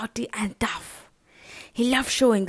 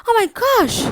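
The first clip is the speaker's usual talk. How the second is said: alarmed gasp; 10 semitones higher, 8 dB louder, much faster than usual. Acted. Mouse realizes danger.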